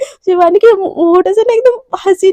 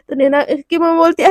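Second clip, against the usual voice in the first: plain reading voice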